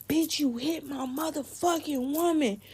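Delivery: high, indignant imitation; mock furious boyfriend voice; retold angry accusation. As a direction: confront her as the outraged boyfriend. Boyfriend confronts friend after hit.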